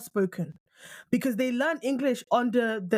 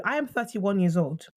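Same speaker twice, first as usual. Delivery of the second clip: ordinary talk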